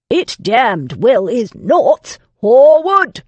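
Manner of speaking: bellowing. A narrator's reading. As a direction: bellow for the butler across the house. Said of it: "he bellowed for the absent butler".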